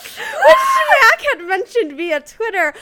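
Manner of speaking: high, loud, laughing through words. Real, not acted. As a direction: laugh while telling it. Laughing excitement.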